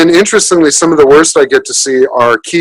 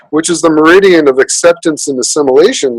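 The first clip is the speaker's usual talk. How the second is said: usual speaking voice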